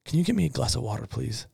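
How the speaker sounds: soft, quick deadpan punchline; hushed punchline. Shown as a demonstration. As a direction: drop the tiny ask in a hush. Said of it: "you lean over and you build the momentum".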